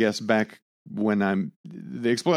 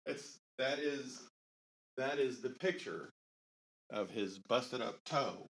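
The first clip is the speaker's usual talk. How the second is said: distant, slow, far from mic; calling back from across the room; 3 semitones higher, 13 dB quieter, slower than usual. Real, not acted. Speaking away from the microphone.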